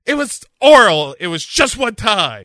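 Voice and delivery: high, loud defensive yell; a daughter yelling excuses at her mom. Acted.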